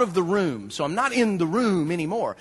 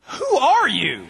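guard asks who are you; 5 semitones higher, 4 dB louder, much slower than usual. Retold or imitated. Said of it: "And she went".

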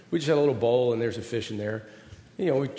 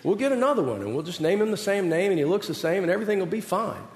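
hammed-up reassuring dad; 6 semitones higher, faster than usual. Dad reassuring crying son.

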